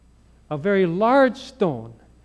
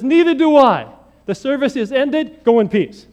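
loud, higher proclamation; bold dismissal; 7 semitones higher, 8 dB louder than usual. Retold or imitated. Seminarian dismissing the chapel service, loud and cheeky. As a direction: announce the ending with cheeky finality.

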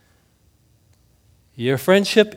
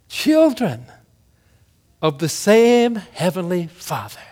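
ordinary talk, nothing marked

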